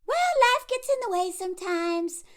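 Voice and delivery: high-pitched sing-along dismissiveness; mock breezy excuse. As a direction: toss it off as a mimicked platitude. Mocking quote of their excuse.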